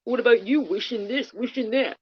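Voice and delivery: lightly mocking sing-song; mocking quote. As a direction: mimic a smug critic. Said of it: "you're gonna say".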